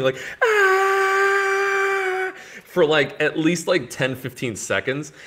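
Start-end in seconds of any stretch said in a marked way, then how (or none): 0.4-2.3 long, loud, high-pitched scream; mock death scream. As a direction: scream as loud as you can like a bad actor dying. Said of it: "just screaming".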